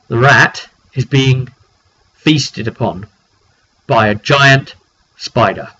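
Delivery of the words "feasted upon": normal talking voice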